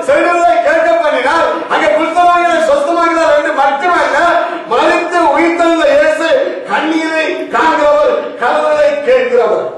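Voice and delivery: forceful sermon declaration; impassioned preaching. Acted. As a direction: preach it out to the congregation.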